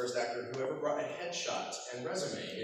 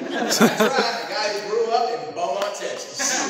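loud, high Texas twang; Beaumont, Texas accent; 6 semitones higher, 12 dB louder than usual. Shown as a demonstration. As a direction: belt it out in a tipsy Texan accent. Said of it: "guess what I start sounding like".

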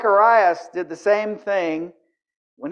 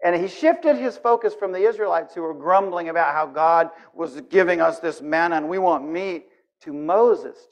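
usual speaking voice

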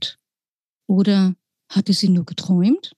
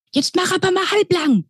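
Lilly scolds, pitched up; scolding; 10 semitones higher than usual. Acted. Lilly scolds the mouse for landing uninvited. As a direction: tell the mouse off sharply. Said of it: "schimpfte Lilly".

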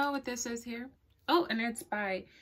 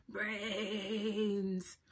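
zombie moan; 2 semitones lower, 6 dB quieter than usual. Acted. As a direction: moan it like the undead. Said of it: "moaned the zombie horde".

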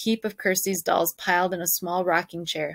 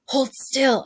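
higher, firm order; commanding; 6 semitones higher than usual. Acted. Anne-Marie orders Ellen to hold still, commanding. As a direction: tell her to freeze, no argument. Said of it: "Anne-Marie commanded".

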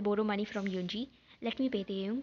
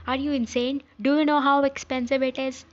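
louder, higher, scolding disbelief; incredulous outrage; 4 semitones higher, 7 dB louder than usual. Acted. Character scolding companion over the expense, incredulous and loud. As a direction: raise your voice in acted outrage.

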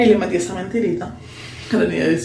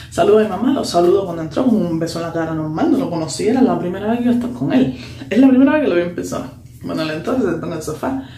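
ordinary talk

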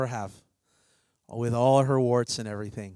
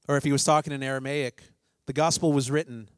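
ordinary delivery

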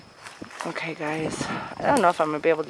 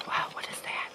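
whispering; 10 dB quieter than usual. Real, not acted. Hears something in cemetery.

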